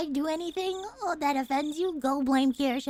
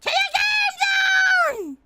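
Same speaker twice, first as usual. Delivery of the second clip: loud high drawn-out shout; hyped battle cry; 7 dB louder, much slower than usual. Pretend. Streamer calling everyone to the game, hyped shout. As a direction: holler it out like a rallying cry.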